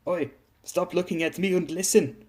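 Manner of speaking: put-on high, scolding German-accented order; indignant German-accented telling-off; mild acted shout. A narrator's reading. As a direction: snap at him without real volume. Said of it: "she shouted at him".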